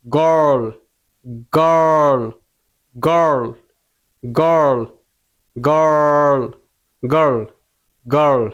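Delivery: গার্ল with a narrow, slightly rounded vowel and held R; narrowed rounded mouth. Shown as a demonstration. Teacher drilling the mouth shape, narrow rounded গার্ল with long R. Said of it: "মুখটা কি চিপা হবে, একটু গুল হবে".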